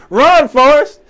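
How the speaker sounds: shouted Run Forrest, high and loud; mock shout. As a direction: yell it like cheering from the stands. Shouted movie quote.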